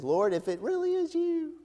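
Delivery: unsure disciple testing the Lord; Peter voiced. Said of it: "Peter says".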